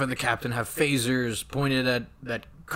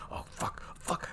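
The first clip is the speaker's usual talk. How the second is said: very soft muttered cursing; fretting to himself; 13 dB quieter than usual. Retold or imitated. Kirk working out how to bait Khan, muttered fretting. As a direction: curse under your breath in Kirk's place.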